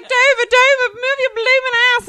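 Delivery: high, loud hammed-up holler; retold film-quote yell. Child quoting My Fair Lady at mom, high loud yell. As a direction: holler it like cheering on a racehorse.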